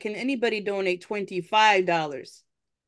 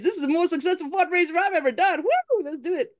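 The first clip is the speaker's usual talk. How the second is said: excited cheer; 8 semitones higher than usual. Real, not acted. Celebrating fundraiser success.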